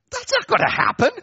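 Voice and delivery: high, loud disbelieving cry; incredulous exclamation. Acted. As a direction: squeal that's not gonna happen in mock outrage. Mocking the silly offer.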